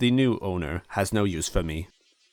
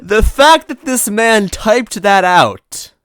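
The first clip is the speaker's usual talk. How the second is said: much higher and louder, slow, cracking up; laughing aside; 13 semitones higher, 13 dB louder, much slower than usual. Real, not acted. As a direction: laugh through the comment.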